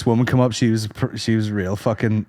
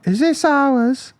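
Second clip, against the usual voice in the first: put-on high slow kid voice; imitating a kid asking; mock kid; 12 semitones higher, much slower than usual. English boy asking about toys: high slow mock kid. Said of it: "He kept going".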